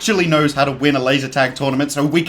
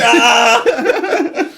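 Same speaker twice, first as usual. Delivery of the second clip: maniac yell; 9 semitones higher, 6 dB louder than usual. Retold or imitated. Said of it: "pick up a keyboard and just".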